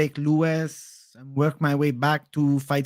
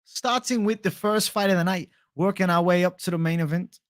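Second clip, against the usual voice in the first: slightly higher presenter voice; MMA guru impression; 4 semitones higher than usual. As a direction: introduce the card like an MMA guru. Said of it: "M M A guru impression".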